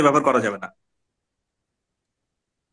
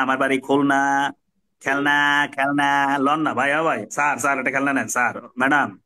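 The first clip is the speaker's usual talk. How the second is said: droning level-toned street vendor pitch; tiresome toy hawker; 3 semitones lower than usual. Acted. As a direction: peddle toys in a monotonous singsong chant. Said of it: "প্রথমজন দেখেন খেলনা বিক্রি করছে এইভাবে".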